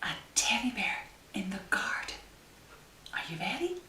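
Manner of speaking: fast secretive whisper. Acted. Showing the child the picture, fast whisper. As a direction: hush the surprise reveal.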